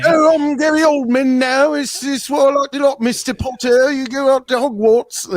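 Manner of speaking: high-pitched, hammed-up Cockney; Cockney impression of Gary Oldman. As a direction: do a squeaky, over-the-top Cockney.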